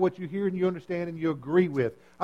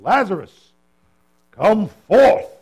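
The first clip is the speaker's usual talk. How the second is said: loud commanding call, much higher; booming King James command, pitched up; authoritative command; 3 semitones higher, 10 dB louder, much slower than usual. Retold or imitated. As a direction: summon him from the tomb with authority. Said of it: "It just sounds so authoritative".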